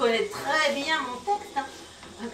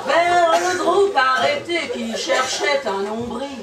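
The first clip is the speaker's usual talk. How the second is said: emphatic dramatic verse; theatrical poetry delivery; poem recitation; 8 dB louder, much slower than usual. Acted. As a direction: declaim it with rolling momentum.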